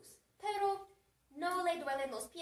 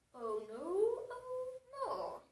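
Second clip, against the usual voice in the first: hammed-up "oh no", leaping high on the repeat; drawn-out wail of pretend alarm; mock dismay; 7 semitones higher, much slower than usual. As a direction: play an appalled parent. Acting dad's dismay at sight.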